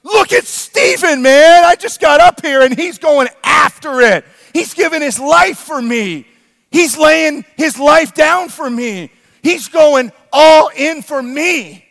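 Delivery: high, loud, exultant cry; thrilled shouting. Acted. The king jumps out of his chair over Stephen, thrilled shouting. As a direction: shout it like you just leapt from your chair. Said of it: "The king jumps out of his chair and goes".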